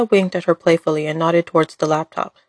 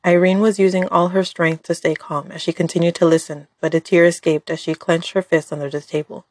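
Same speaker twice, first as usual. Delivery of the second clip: usual speaking voice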